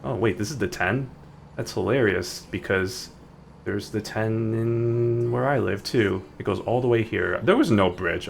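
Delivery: normal talking voice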